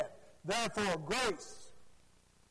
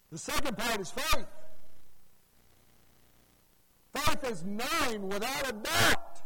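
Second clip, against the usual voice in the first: loud, rising pulpit preaching; full-force gospel sermon; fiery preaching; 2 semitones higher, 5 dB louder than usual. Acted. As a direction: drive the point home to the congregation.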